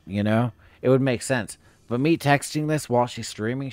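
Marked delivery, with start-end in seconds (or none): none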